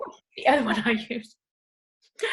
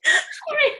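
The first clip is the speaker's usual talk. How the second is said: hammed-up apology; 4 dB louder than usual. Exaggerated apology after laughing.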